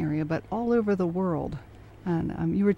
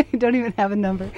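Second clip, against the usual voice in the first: laughing; 4 semitones higher, much faster than usual. Real, not acted. Host laughs at guest's answer.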